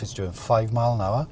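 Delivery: usual speaking voice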